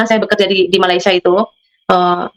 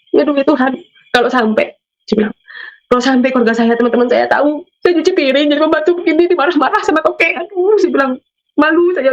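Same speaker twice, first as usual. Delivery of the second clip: higher, pleading sigh of shame; retold tearful lament; 5 semitones higher than usual. Maid retells her shame at washing dishes, mildly tearful and higher. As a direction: moan it higher in mock distress. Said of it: "saya nangis".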